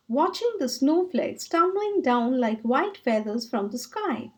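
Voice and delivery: ordinary delivery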